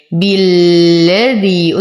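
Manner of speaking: full i on ب, held l, long final ī in بالذي; slow tajweed recitation. Shown as a demonstration. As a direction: chant بالذي slowly, leaning on the doubled l. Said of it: "نشد اللام". (ترتيل بالذي مع شد اللام ومد الياء)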